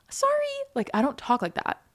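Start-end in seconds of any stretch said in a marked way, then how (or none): 0.1-0.3 tiny, very high "sorry"; high-pitched apology. Retold or imitated. Host imitating themselves bumping into someone, high-pitched apology. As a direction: apologise in a squeaky raised pitch. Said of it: "my voice gets so high".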